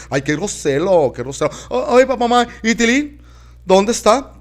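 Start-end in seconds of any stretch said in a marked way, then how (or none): 1.7-4.3 excited aunt. Acted.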